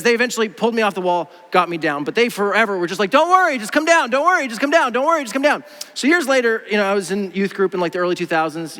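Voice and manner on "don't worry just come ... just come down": high-pitched, singsong mock urging; insistent high mock reassurance; repetitive coaxing. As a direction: imitate someone calling up the same plea. Imitates people endlessly coaxing him down.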